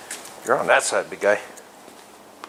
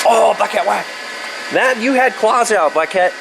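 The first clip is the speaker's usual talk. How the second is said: high teasing pet voice; 7 semitones higher, 4 dB louder than usual. Pretend.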